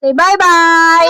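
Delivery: slow "bye-bye" with long vowels; "bye-bye" held long, coaxing; drawn-out coaxing. Real, not acted. Repeats the prompt, drawn out.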